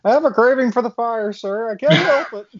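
put-on excited high-pitched teenager; high, loud teen voice. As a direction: pitch up and push it loud like an eager kid. [name] voiced, pyromaniac teen.

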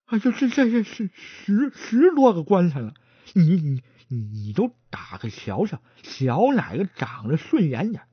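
stammering, tripping over every word; a nervous stutterer begging to lead the way. Acted. 结巴的伙计结结巴巴地求着带路.

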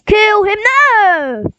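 high, drawn-out cry of dismay; urgent yell. Real, not acted. Gamer calling out as a kill goes wrong, high dismayed yell. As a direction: yell out high, stretching the last word.

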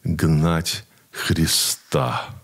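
very slow, grave, hushed warning; stern slow rebuke. Retold or imitated. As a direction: spell out each word slowly like a stern elder. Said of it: "he looks me straight in the eye, and he goes".

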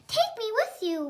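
high pleading plea; begging. A narrator's reading. Little sister begging her oldest sister, high pleading. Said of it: "Wendy begged".

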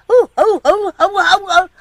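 high, loud put-on coughing whoops; fake whooping cough. Child fakes a whooping cough to fool Mama, high loud whoops. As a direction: cough it up like a kid faking sick. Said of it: "like I'm out of breath".

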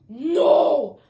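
sharp protesting yell; loud no. Real, not acted. Accused in a meeting.

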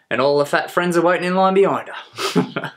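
high, loud jeering punchline; gleeful mockery. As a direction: crow it, about to burst out laughing. Gleefully mocking, laughs right after.

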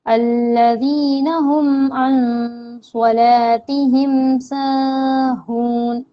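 slow, drawn-out Quranic chant; Quran recitation. Shown as a demonstration. Teacher reciting the verse for students, slow chant. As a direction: recite each word slowly, holding the long vowels.

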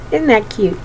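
ordinary delivery, nothing marked

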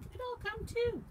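very high little mouse voice. A narrator's reading. Little mouse volunteers to come along, high-voiced. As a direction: pipe up high like a small animal. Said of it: "said Mouse".

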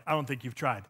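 low and quiet, disbelieving; doubting listener. Retold or imitated. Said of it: "You're like".

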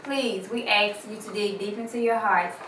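ordinary talk, nothing marked

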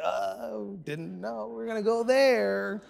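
high, drawn-out mock retreat; awkward backing away. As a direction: stretch it out slowly, edging out of the conversation. Friends recoiling on hearing they're pastors.

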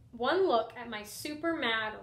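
usual speaking voice

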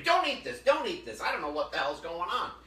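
loud, high mock warning; retold alarmed warning. Imitating John warning everybody off the meat, loud alarmed call. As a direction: imitate calling out urgently to stop everyone.

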